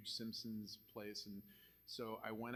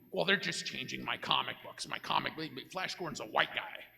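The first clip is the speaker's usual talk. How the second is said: louder, griping fanboy complaint; whining comic fan; 7 dB louder than usual. Retold or imitated. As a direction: whine like an offended old comic artist. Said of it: "They get upset".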